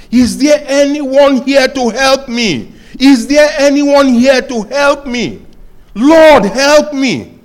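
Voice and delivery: high, loud cry for help while slipping; desperate shouting. Retold or imitated. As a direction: shout for help like you're hanging off a cliff. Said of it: "he started shouting, crying".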